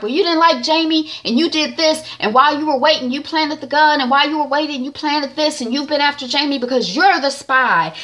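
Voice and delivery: mock prosecutor pinning the blame, pitched high. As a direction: raise the pitch and jab the accusation. Proctor accusing agent in court.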